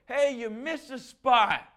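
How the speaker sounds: high, loud, slow angry call; yell from the window. Retold or imitated. Annoyed homeowner yelling from his window. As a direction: bellow it across the lawn. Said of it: "I hear him yell".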